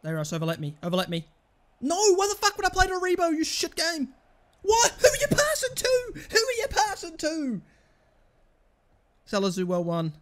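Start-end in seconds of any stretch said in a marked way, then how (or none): 1.8-4.1 high, loud, fast furious rant at the game; real gamer rage, pitched up and loud; angry outburst. Real, not acted. Raging at game AI pass. 4.6-7.6 high, loud indignant shouting; real outraged yell at a bad pass; angry yelling. Real, not acted. Yelling at teammate AI.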